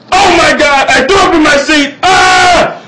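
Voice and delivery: high, loud shriek of horror; panicked shout. Retold or imitated. Just threw up in the car seat, shrieking in panic. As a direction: re-enact it as a screaming freak-out.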